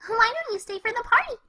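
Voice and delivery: ordinary talk, nothing marked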